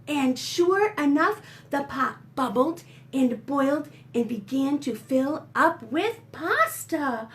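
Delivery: usual speaking voice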